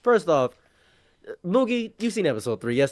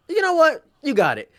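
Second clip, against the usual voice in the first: high excited burst; eager high-pitched yes; excited Sanji; 12 semitones higher than usual. Retold or imitated. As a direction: jump up high, thrilled. Retelling Sanji's excited reply.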